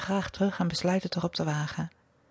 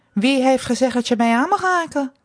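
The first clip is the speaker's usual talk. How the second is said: raised, louder stern challenge; indignant scolding; 6 semitones higher, 5 dB louder than usual. A narrator's reading. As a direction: reprimand him like a strict mistress. Mistress scolds him for touching. (verontwaardigd, streng)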